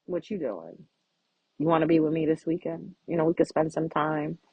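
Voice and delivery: low, smooth come-on voice; a low, slick pickup line; laying it on strong. Retold or imitated. To a crush, a low smooth come-on. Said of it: "you lay it on them strong".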